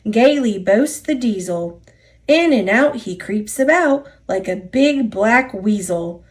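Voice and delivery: ordinary delivery, nothing marked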